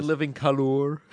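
"Colour" with the U exaggerated; mock British "Colour". Co-host mocking British spelling, sounding the U in "Colour". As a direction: stretch "Colour" into col-OOR. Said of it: "having a U in favorite and, and color".

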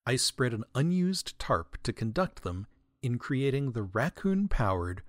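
ordinary delivery

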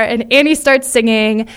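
ordinary talk, nothing marked

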